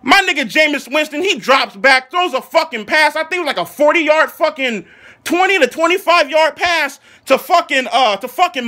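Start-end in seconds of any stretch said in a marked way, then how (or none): none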